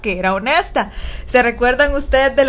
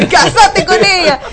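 higher, slow insistence laughing; playful matchmaking aunt; mock pushy aunt; 6 semitones higher, 4 dB louder than usual. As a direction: nag her to marry, half laughing. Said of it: "le decía".

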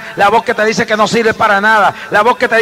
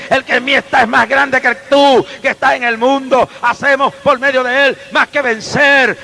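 ordinary talk

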